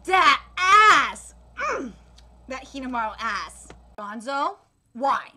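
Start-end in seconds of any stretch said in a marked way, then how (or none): none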